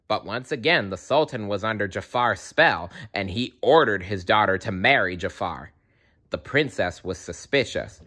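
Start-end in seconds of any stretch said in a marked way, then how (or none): none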